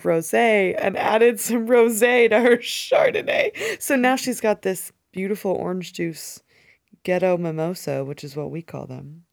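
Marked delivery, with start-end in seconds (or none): 0.0-3.8 laughing. Real, not acted. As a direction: voice rising into laughter. Laughing confession of mixing drinks.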